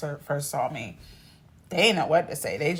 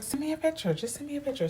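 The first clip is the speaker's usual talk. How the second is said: much higher, eager friend voice; friend's higher insistent voice; lightly voiced friend; 11 semitones higher, faster than usual. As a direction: play the pushy coworker.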